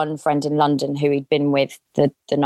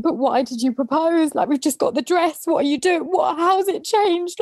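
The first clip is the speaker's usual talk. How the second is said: high, strained pleading, voice pushed way up; retold desperate pleading; 13 semitones higher than usual. Retelling begging her fiancé on the floor, high desperate plea. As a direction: beg him to explain, close to breaking. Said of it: "on the actual floor begging him".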